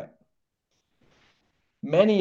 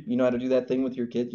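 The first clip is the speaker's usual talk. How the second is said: normal talking voice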